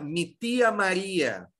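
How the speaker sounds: "mi tia Maria" in Spanish, TEE-a, ma-REE-a; stressed I in Spanish. Shown as a demonstration. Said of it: "vai tá acentuada tanto tia como Maria". ("mi tia Maria" em espanhol, com o I bem tônico)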